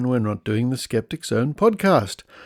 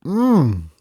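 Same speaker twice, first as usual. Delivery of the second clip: appreciative mmm; 6 semitones higher, 3 dB louder than usual. Pretend. Savouring the soup name.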